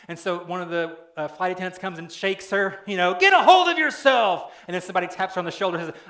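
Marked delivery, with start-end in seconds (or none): 3.1-4.5 much higher, loud yell at a panicking woman; frantic shout. Retold or imitated. Flight attendant shaking a panicking passenger, frantic yell.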